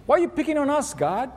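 high, pleading complaint, mock grievance; whiny victims. Israelites grumbling at God as victims, high mock whine. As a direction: whine like a picked-on child. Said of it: "They're becoming victims".

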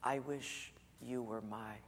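put-on a teacher whispering to a little girl; soft whisper. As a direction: whisper it tenderly across the room. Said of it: "Miss Leonard said in a whisper".

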